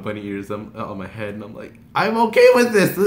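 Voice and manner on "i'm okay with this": high, loud thrilled exclamation; giddy freak-out. Retold or imitated. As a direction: blurt it out, barely containing excitement. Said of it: "I was freaking out".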